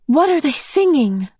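eager high question; excited. Acted. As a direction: ask it bursting with wonder. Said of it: "Meg asked excitedly".

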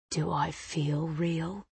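low, slow breathy whisper. A narrator's reading. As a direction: whisper it close to her ear. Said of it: "he whispers back".

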